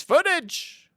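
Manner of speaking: high, loud presenting shout; showy high-pitched reveal; triumphant announcement. Real, not acted. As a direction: unveil it with a high yelp. Presents his long-promised proof.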